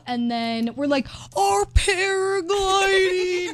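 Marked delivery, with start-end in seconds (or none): 1.4-3.5 drawn-out, high slow-motion call. Retold or imitated. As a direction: stretch every syllable like a slowed-down film. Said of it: "like, the slow motion".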